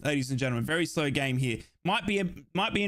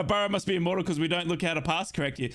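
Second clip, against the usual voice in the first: ordinary delivery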